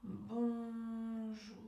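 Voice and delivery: BONJOUR very slow, each syllable held on one level note; stretched syllables. Shown as a demonstration. Coach leads stuttering exercise after a breath, drawn-out BONJOUR. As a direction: hold BON long, glide evenly into a long JOUR. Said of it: "allonger le mot, allonger la syllabe".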